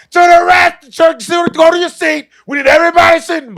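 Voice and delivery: high, loud rushed shouting; hammed-up attendant yelling; imitated yelling. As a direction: order passengers back at full voice. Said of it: "she's clearly yelling".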